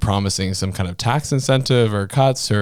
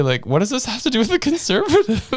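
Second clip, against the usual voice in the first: high, giggling question; amused incredulous quote; laughing mock question. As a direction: laugh through the disbelief.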